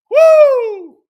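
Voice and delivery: loud, high-pitched whoop; laughing whoop. Real, not acted. Commentator overcome by his own joke, whooping. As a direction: whoop between bursts of laughter.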